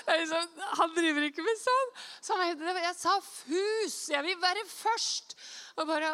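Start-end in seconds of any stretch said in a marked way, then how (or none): 2.8-5.3 high, slow, drawn-out insistence; mock-exasperated clarification; emphatic correction. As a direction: spell it out slowly, pitched up.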